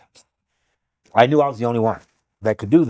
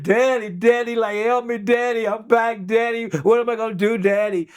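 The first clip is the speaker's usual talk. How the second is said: high-pitched pleading, not full volume; mock scream, pitched up; retold screaming; 8 semitones higher than usual. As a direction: imitate a daughter crying out for her dad. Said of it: "And she was screaming".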